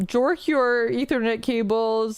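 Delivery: ordinary talk, nothing marked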